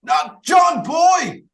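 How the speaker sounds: very high, long drawn-out mock wail; mock anguished shout. Host joining the mourning for John Boy, mock anguished shout. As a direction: howl it slowly with hammed-up grief.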